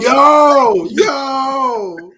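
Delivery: loud, high, drawn-out yo; big hyped-up outburst; loud exclamation. Real, not acted. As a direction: holler it out in agreement. Exclaims over the co-host's accent complaint.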